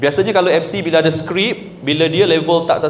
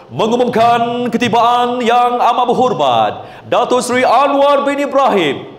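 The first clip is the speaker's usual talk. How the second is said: high, sustained MC announcement; high announcer intonation; 6 semitones higher than usual. Shown as a demonstration. Third style of MC announcing Datuk Seri Anwar, high and sustained. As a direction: ring out the name up high. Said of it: "Lain kan?".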